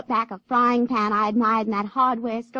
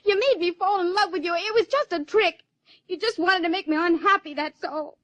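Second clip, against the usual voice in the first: higher, reproachful accusation; hurt anger; 6 semitones higher than usual. Acted. Abby accuses Mister Wright of tricking her, hurt and angry. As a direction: lash out at the man who tricked you.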